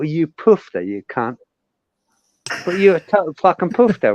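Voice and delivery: light mock Cockney insult; tossing off the insult in a Cockney accent. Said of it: "I can do it full Cockney".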